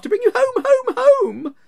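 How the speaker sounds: put-on high, loud, delighted child; a little girl bubbling over with joy; gleeful little girl. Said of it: "clapping her tiny hands and bending down to laugh".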